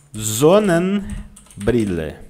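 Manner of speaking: Sonnenbrille very slow, S as a z; slow syllables. Shown as a demonstration. Teacher models S as z for the class. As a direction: spell out Sonnenbrille slowly, z sound first. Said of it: "S antes de vogal, som de Z".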